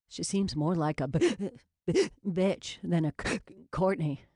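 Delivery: usual speaking voice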